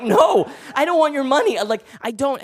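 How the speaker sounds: loud, high-pitched protest; exasperated refusal. Retold or imitated. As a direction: cry out in comic desperation, refusing. Refusing money pressed on him again.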